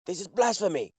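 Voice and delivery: high, indignant outburst; outraged protest. Acted. Player acts outraged protest to sheriff.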